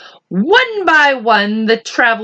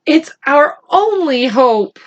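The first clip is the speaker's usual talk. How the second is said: ordinary delivery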